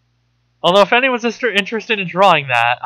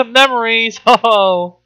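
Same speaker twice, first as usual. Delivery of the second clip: laughing through the word memories, higher and louder; 8 semitones higher, 7 dB louder than usual. Real, not acted. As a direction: giggle it out with a ho ho. Laughing at imagined morbid scrapbook.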